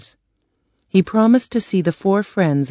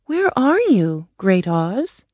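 Dorothy; 3 semitones higher, slower than usual. Acted. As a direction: call out to an unseen wizard as a young girl. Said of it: "Dorothy asked".

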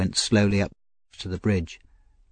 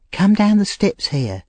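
put-on Nancy, nervous young woman; 12 semitones higher, 4 dB louder than usual. Nancy voiced high throughout.

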